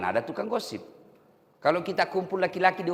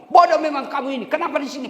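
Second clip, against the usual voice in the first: higher, louder insulting snap; angry husband; 12 semitones higher, 8 dB louder, faster than usual. Acted. Husband burned by sambal scolding his wife, angry and loud.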